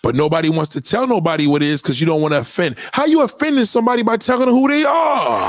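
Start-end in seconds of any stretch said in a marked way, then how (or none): 2.9-5.5 high, raised demand; pitched-up shouting rant; exasperated shout. Real, not acted. Ranting about honesty.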